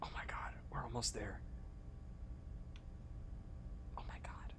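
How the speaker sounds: breathless excited whisper. Real, not acted. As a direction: whisper it in suspense. Close to the goal.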